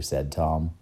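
usual speaking voice

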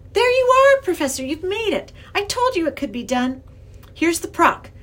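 put-on high, loud excited girl; triumphant. A narrator's reading. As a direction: exclaim triumphantly at making it. Girl celebrates successful crossing.

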